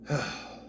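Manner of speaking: breathy exhaled sigh; exasperated breath out; weary sigh. Real, not acted. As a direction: let out a wordless sigh. Weary pause before criticising ending.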